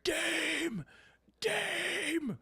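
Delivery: high, calling out a name across the arena; retold scream. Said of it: "I'm screaming".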